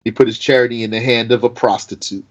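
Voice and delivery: ordinary delivery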